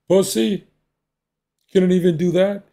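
high sneering mock of the dad; mocking father. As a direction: scoff at the son like a cruel father. Father mocks his son.

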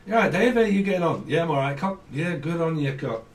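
chirpy northern English greeting; playful up-north accent, lilting. Acted. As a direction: imitate matey Northerners swapping hellos. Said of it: "people up north call people cock".

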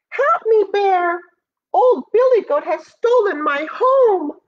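high, loud cry for help; distressed badger. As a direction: plead urgently in a high-pitched badger voice. Badger pleads for help in story.

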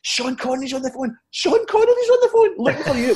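very high-pitched mock-frantic announcement; excited imitation. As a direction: relay huge news as a giddy colleague, squeaking upward. Colleague breathlessly announces famous caller.

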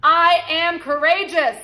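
high, loud and slow, the peak of the chant; powerful affirmation. Shown as a demonstration. Coach leading the final repeat of the chant, high and loud. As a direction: belt out "I am courageous" slowly at full power. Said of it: "One more time".

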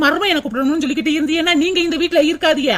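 usual speaking voice

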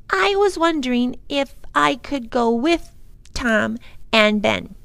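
put-on little girl voice. A narrator's reading. Little girl asks parent.

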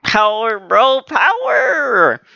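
drawn-out high hype call; slow soaring fan chant; hyped cheer. Real, not acted. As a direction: stretch out power and cheer the hero on. Cheering on the fight.

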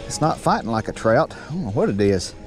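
usual speaking voice

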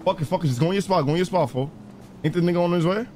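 usual speaking voice

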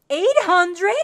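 loud, high, incredulous outburst; shouted astonished question; shocked at price. Real, not acted. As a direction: blurt it out in disbelief.

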